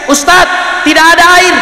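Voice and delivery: high, anxious question to the ustadz; worried congregant. Retold or imitated. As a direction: call out the problem like a fretting villager. Jamaah bertanya ke ustadz. (jamaah cemas bertanya dengan suara tinggi)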